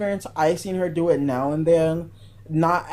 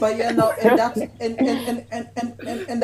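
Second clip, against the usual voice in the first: laughing; 6 semitones higher than usual. Real, not acted. Stuttering while laughing.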